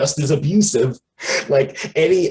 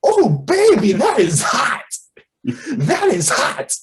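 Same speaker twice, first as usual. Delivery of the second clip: Shriek's voice; 9 semitones higher, slower than usual. Retold or imitated. Villainess thrilled by tendrils, high sultry squeal. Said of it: "and she was like".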